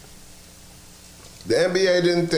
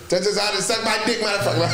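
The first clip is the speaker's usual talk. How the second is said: pretend Giannis; 7 semitones higher, faster than usual. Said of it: "If I was Giannis".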